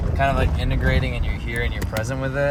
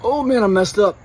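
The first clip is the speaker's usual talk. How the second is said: pained groan; 9 semitones higher, 6 dB louder than usual. Real, not acted. Struggling after the run.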